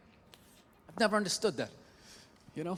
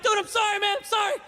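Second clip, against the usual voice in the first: higher, louder panicked apology; flustered apology; 10 semitones higher, 4 dB louder than usual. Retold or imitated. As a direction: apologise fast to the passerby. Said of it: "I'm like".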